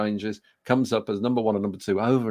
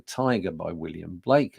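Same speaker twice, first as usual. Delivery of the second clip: usual speaking voice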